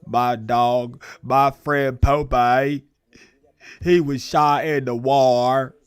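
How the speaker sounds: slow, hammed-up Forrest Gump. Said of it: "that sound like some Forrest Gump shit".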